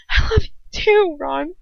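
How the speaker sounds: a touch of tearful longing; understated whimpered confession. Acted. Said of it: "I whimper".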